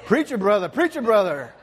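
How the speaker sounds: high, loud mock amen-corner shout; playful imitation of congregation cheering on the pastor; congregation yell. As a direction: holler it like an excited church member. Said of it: "they yell out".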